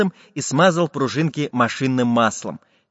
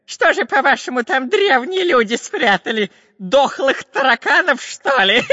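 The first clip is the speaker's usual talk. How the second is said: high, loud mockery ending in a giggle; scoff, then titter shrilly; Dyrka scoffing; 12 semitones higher, 4 dB louder than usual. A narrator's reading. Robber mocks the professor's doubt, laughing. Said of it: "засмеялся разбойник".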